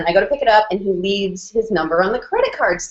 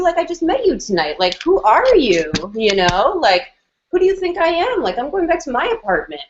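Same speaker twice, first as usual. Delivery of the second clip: indignant refusal; 9 semitones higher than usual. Retold or imitated. Refusing to go to hotel.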